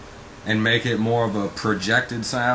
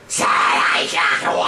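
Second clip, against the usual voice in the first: pushed high scream with heat; 3 semitones higher, 3 dB louder than usual. Shown as a demonstration. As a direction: push air out in a heat scream. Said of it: "With heat".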